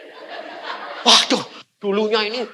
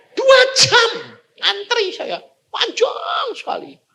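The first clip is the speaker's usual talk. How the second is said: exasperated outcry; 14 semitones higher than usual. Retold or imitated. Preacher tells the congregation about the queue, exasperated outcry.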